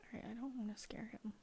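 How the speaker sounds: hushed breathy whisper. Real, not acted. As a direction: whisper so as not to scare.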